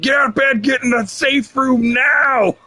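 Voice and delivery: high-pitched shouted order, drawn out at the end; retold urgent yell. Father yelling at his kid as the tornado hits, urgent shout. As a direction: re-enact yelling a child to safety. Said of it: "I'm yelling at my".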